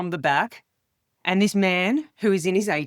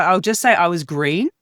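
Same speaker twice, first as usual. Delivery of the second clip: ordinary talk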